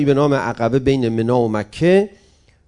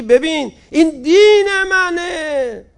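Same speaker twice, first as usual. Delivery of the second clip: crying out; 4 dB louder, slower than usual. Acted. Proud retort to a mocker.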